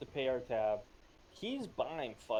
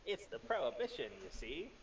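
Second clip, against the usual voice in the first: put-on old-time gangster voice; 13 dB louder, faster than usual. Continues the thirties gangster bit.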